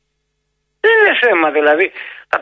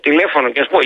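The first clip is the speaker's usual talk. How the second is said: normal talking voice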